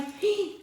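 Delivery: quick startled intake of breath; real surprised gasp; sharp gasp. Real, not acted. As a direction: gasp as the snack spills. Spills peanuts, sudden gasp.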